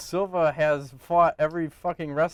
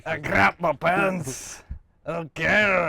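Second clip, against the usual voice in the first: hammed-up Irish; 4 semitones higher, 4 dB louder, slower than usual. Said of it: "You sound like Irish".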